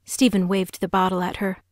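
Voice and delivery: ordinary delivery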